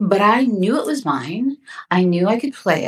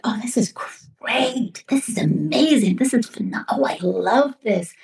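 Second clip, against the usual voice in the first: savoring each gushing word, drawn out; gushing, stretched-out enthusiasm; retold delight; 3 semitones higher than usual. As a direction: relive falling in love with a script. Reliving delight at reading script.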